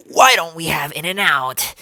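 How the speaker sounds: slow, loud, excited outburst; eager exclamation. Retold or imitated. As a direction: blurt it out as a big craving-driven plea. Quotes own past craving, exclaiming.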